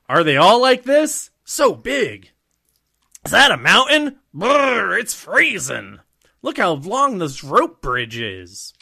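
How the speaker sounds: high, loud awestruck voice; excited wonder. Acted. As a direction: gasp at the size of it all. Luffy's lines acted in wonder.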